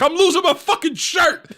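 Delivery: high-pitched, aggrieved rant; losing his shit. Retold or imitated. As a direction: imitate a bettor protesting to security. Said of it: "My father started losing his shit".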